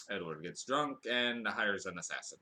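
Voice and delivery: ordinary talk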